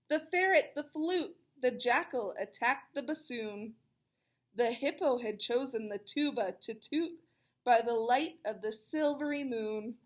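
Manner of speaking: lilting rhyming read-aloud; storybook rhyme voice; reading to children. A narrator's reading. Reads rhyming picture book.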